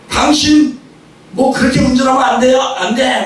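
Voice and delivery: mock road-rage argument; angry quarrel. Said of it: "이제 화가 났죠".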